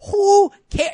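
exasperated shout. Real, not acted. Outraged rant to co-hosts.

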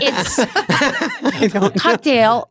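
put-on slow Colombian-accented cocktail; drawn-out Colombian accent; Sofia Vergara impression. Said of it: "impression of Sofia Vergara".

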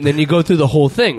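ordinary talk

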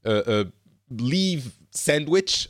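normal talking voice

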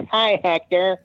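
high, sweet mocking voice; cute Hector voice. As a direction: coo it like a cutesy imitation. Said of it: "my Hector voice".

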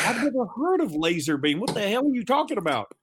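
mock exasperated. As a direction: act incredulous dad. Himself baffled, retold.

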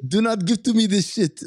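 high, exaggerated foreign accent; Maurice. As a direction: refuse indignantly in a put-on accent. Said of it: "Maurice from Tiptoes".

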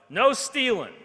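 a student shouts a rule at the teacher; yelled out. A narrator's reading. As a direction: bark the rule out across the room. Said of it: "One fella yelled out".

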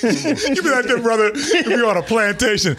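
mock voice. Mocking slave voice.

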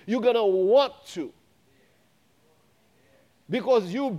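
rising, insistent preacher cadence; preaching. Acted. Pastor preaching to the church and viewers, fervent. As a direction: build the crowd up with conviction.

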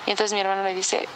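usual speaking voice

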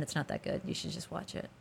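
a hushed, fake modest aside; mock underselling whisper. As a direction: whisper it, pretending it's only okay.

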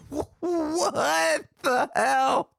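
very slow, high-pitched wail; exasperated disbelief. Real, not acted. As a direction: stretch it into a loud, baffled cry. Outburst at absurd assignment.

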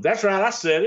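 lightly voiced smug heel; mimicking catchphrase. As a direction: gloat like Buddy. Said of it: "you follow it up with".

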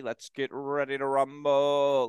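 drawn-out ring announcer catchphrase; Michael Buffer. Retold or imitated. Imitating the famous boxing announcer, drawn-out announcer call. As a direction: imitate a boxing announcer, stretching the words slowly. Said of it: "who's the guy who does W W E".

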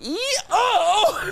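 high mock squeal with a laugh; imitated squeal. Retelling friend's slip, high squealing mock yelp. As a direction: squeal like slipping mid-jig. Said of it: "He goes".

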